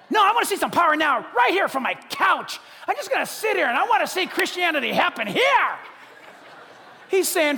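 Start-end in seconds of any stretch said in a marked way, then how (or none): 0.1-5.8 fast, loud, petulant demand; demanding whine shout. Retold or imitated. Preacher mocking a couch believer, shouting petulantly. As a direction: insist like a spoiled child.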